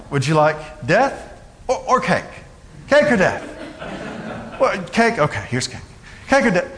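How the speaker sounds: lightly put-on British comic voice; British comedian's bit. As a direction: play a British inquisitor politely offering death or cake. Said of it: "if the British did the Spanish Inquisition".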